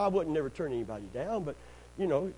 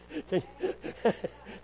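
wheezy laugh without words; 8 semitones higher than usual. Real, not acted. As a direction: laugh ruefully at your own struggles. Laughs at dog paddle remark.

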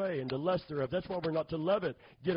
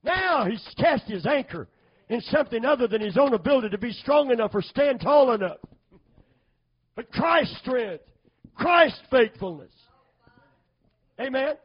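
high, loud, emphatic sermon delivery; fervent preaching; 8 semitones higher, 9 dB louder than usual. Real, not acted. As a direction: drive the point home forcefully. Preacher at full force.

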